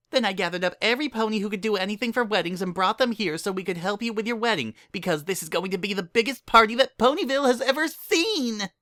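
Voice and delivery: high, fast, breathless chatter; excited Pinkie. Acted. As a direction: gush it out in one excited rush. Pinkie rapid excited speech.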